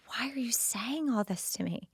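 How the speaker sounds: hushed, wounded whisper; tearful whisper. Retold or imitated. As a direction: ask it barely breathing, hurt. Crying daughter asks father.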